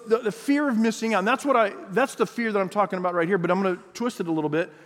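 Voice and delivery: ordinary talk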